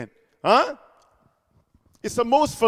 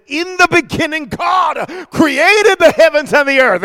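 slow, booming shout, much louder and higher; full-force shout; 7 semitones higher, 8 dB louder than usual. Acted. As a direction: thunder the first verse of Genesis out slowly. Preacher quoting Genesis at full force.

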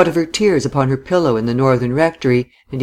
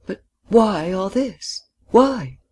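slow, rising, pressing why; insistent questioning; 7 semitones higher, slower than usual. A narrator's reading. Woman demanding why he marries, insistently. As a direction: press him for a reason. Challenging his plans.

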